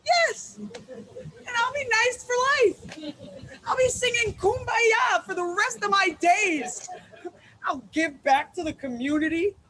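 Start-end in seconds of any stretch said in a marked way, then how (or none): 0.1-0.6 a high, sugary "yes"; sickly nice yes. Pretend. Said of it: "And I'll just nicely say". 0.6-6.9 anguished outburst. Acted. Dreading a life of niceness.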